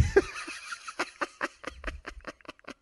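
very high, soft wheezy giggling fit; helpless laughter. Real, not acted. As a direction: dissolve into high giggles at your own joke. Cracks up at own voice.

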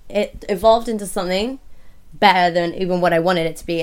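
ordinary talk, nothing marked

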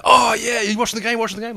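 high, excited imitation of an eager fan; fired up. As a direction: burst in all keyed up, retelling his excitement. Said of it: "He was fired up".

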